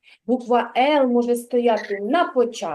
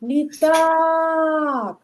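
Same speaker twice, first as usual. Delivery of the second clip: Літак called out long, stretching the final а; calling the word out; 2 semitones higher than usual. Shown as a demonstration. Teacher calls out the word for the class, drawn out. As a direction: call the word out like across a field. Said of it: "а ну погукайте слово".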